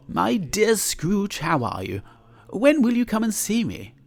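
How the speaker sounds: high, cheerful mock greeting; a merry passer-by calling out, lightly voiced; gladsome greeting. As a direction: sing out a hearty hello.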